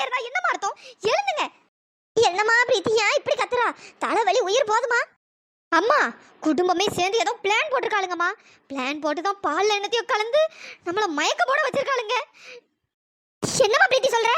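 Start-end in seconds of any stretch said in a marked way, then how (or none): none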